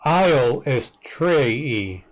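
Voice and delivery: Isle is Trei drawn out slowly; very slow, deliberate Isle is Trei; pronunciation demo. Shown as a demonstration. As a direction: sound out Isle is Trei piece by piece. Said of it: "[name] pronounced her own name".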